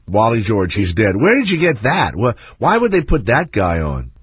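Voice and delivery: ordinary delivery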